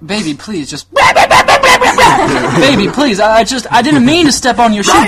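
high, loud, laughing mock pleading; hammed-up groveling to an angry girlfriend; begging boyfriend. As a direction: overplay a desperate guy begging. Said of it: "you're like".

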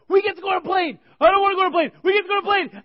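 put-on high, loud kid squeal; imitating freaking-out kids. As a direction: shriek it like overexcited little kids. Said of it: "were, like, freaking out".

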